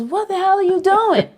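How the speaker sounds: very high, loud mock alarm; retold shocked yell; startled outburst. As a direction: demand it in high-pitched disbelief. Retold startled bandleader at stairs.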